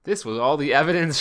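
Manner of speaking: ordinary delivery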